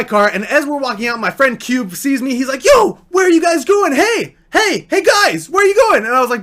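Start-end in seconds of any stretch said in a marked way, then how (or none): 2.6-6.0 high, loud mock shout after departing friends; calling out. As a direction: holler eagerly to catch their attention. Friend calls out as they leave.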